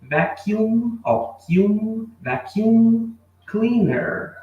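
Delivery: teacher spells out vacuum cleaner slowly for students; slow pronunciation model. Shown as a demonstration. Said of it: "Pronúncia vacuum".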